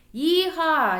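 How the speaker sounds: high, loud yee-haw; loud gleeful cowboy whoop; cowboy yell. A narrator's reading. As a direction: whoop like a rodeo rider. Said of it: "I yelled".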